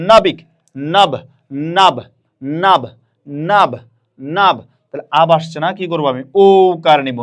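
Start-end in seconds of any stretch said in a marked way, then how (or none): 0.1-4.6 "নাবিক" cut down to a repeated, isolated "নাব"; teacher's falling model of "নাব" for repeating; pronunciation drill. Shown as a demonstration. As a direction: sound out "নাবিক", then just "নাব" with pauses. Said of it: "উচ্চারণ করেন আমার সাথে".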